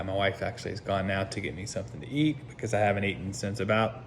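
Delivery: normal talking voice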